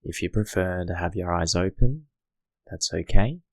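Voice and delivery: hushed guiding voice for settling in; guided meditation. Acted. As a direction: ease the listener into stillness, gently.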